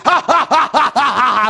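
big imitated horse laugh; hammed-up string of ha-ha-ha; mocking laugh. Trucker laughing at praying old man, mocking.

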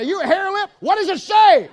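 high, loud jeering; mocking taunt. Preacher taunts the flustered manager, loud and high.

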